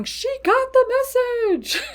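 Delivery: a spirit proudly announcing she got it; pleased spirit guide. Retold or imitated. Spirit guides reacting to the witch getting the message, high and delighted. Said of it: "Your spirit guides are going".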